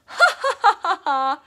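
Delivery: hammed-up laughing like a clown. Shown as a demonstration. Teacher asking kids to laugh like a clown, hammed-up laugh. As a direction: laugh out big and silly. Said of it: "Can you guys laugh?".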